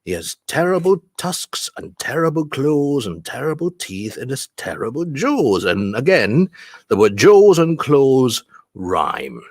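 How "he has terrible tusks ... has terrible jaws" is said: "terrible" bouncing higher on each beat; underlined stresses. Shown as a demonstration. Teacher underlining stressed syllables, rhythmic beats on every "terrible". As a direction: punch "tusks", "claws", "teeth" and "jaws". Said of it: "we're gonna underline the stressed syllables".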